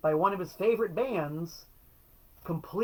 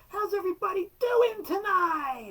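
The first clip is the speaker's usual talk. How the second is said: mock rock frontman; 4 dB louder than usual. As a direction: shout it like a cheesy stadium singer. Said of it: "You know, or".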